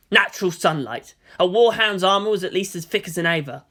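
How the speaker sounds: usual speaking voice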